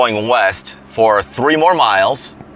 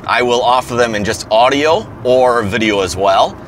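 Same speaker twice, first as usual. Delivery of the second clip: ordinary talk, nothing marked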